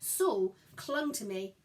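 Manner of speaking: usual speaking voice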